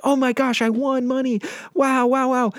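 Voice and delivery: high, thrilled squeal of delight; excited. Retold or imitated. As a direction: gush like a lucky winner. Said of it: "people would ... be like".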